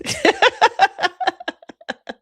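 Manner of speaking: high cackle. Real, not acted. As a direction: burst of high, loud-ish laughter. Laughing at own joke.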